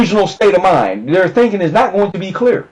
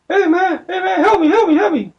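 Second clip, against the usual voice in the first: much higher, pleading voice of a stuck man; stuck addict begging; 14 semitones higher than usual. Retold or imitated. Retelling the stuck man in the alley begging for help, high pleading whine. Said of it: "talking about".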